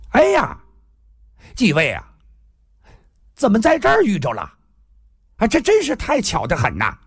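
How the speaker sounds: high-pitched, exclaiming newcomer; newcomer calling in. Acted. Newcomer calls in from outside. (门外来客高声惊喜招呼)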